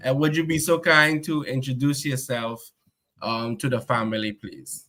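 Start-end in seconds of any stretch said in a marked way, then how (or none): none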